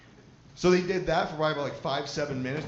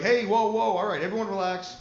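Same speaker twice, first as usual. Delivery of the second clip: loud, higher call to calm everyone; calming the set; 5 semitones higher, 5 dB louder than usual. Retold or imitated. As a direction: raise your voice to settle a crowd. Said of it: "They're like".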